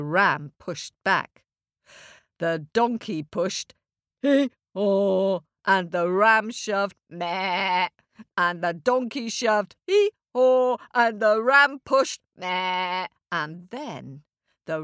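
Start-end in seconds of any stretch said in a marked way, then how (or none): 4.2-5.4 loud mock hee-haw; donkey bray. Donkey and ram shoving. 9.9-10.8 higher, louder hee-haw; donkey bray. Acted. Donkey and ram shoving.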